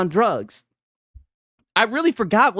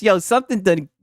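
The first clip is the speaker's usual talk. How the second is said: ordinary talk, nothing marked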